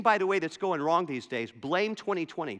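usual speaking voice

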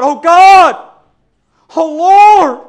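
loud, high anguished cry out to God; desperate cry. Retold or imitated. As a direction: cry it out like a father at his child's side. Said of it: "he was groaning".

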